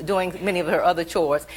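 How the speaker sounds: normal talking voice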